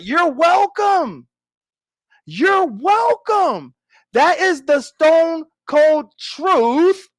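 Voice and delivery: slow, drawn-out smug thanks. Acted. Telling the viewers they owe him everything, slow smug drawl. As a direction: stretch it out, savoring the credit. Smugly claiming credit.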